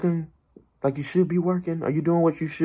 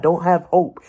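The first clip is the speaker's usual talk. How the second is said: ordinary talk, nothing marked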